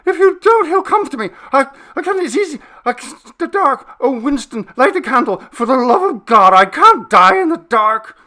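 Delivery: high, loud, desperate begging; terrified cry for light; panicked pleading. Acted. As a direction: beg as if death is in the room. Terrified man begging in dark.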